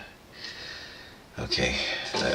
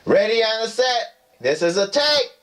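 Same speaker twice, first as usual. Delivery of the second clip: high announcing call of a film set; director call; 13 semitones higher than usual. Pretend.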